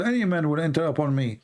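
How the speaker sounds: usual speaking voice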